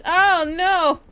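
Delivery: loud, high "oh no"; alarmed cry. Real, not acted. Gamer suddenly spots the zombies, alarmed. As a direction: yelp it in alarm.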